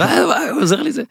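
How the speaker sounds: high-pitched imitation of an excited kid; squeaky kid, amazed. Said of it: "יש עוד שני ילדים עכשיו".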